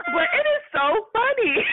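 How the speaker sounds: high, laughing through the apology; laughing while talking. Retold or imitated. HR lady apologizing while cracking up, laughing through words. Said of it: "she busts out laughing".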